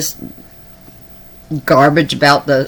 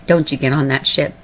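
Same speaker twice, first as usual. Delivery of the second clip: ordinary delivery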